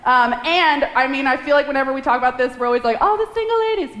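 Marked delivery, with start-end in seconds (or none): 3.0-4.0 high, teasing sing-song; hammed-up voice of teasing wedding guests; mock sing-song guests.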